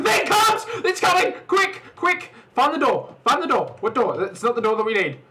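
high, loud frantic urging; panicking gamer. Real, not acted. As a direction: urge them on in a panic. Fleeing the monster.

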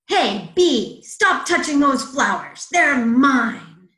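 loud, bossy frog telling off a bee; scolding bully. A narrator's reading. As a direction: bark orders at the intruder.

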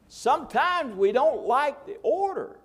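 Sometimes we don't like the order sung out high and slow; preacher's slow high call; drawn-out preaching cry. Shown as a demonstration. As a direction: stretch the word Sometimes way up, slow.

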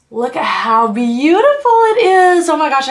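thrilled gush at the eyeshadow palette; excited. Real, not acted. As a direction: burst out in delight at the colors. Unboxing a dreamed-of palette.

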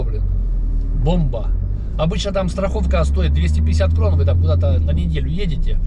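ordinary delivery

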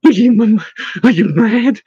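put-on high, stammering scared boy; frightened boy. A narrator's reading. Henwin protesting the plan to investigate, like a frightened boy. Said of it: "sounded like a frightened boy".

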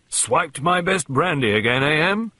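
jovial colonel ribbing his wife; good-humoured Colonel. A narrator's reading. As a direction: call it out in genial bluster. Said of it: "said the Colonel good-humouredly".

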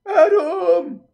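very high, loud warning cry; mock-alarmed yell. Streamer calling out to the storyteller, mock-alarmed yell. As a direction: holler the name in exaggerated alarm. Reacting to story's forbidden question.

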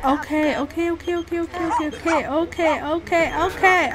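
ordinary talk

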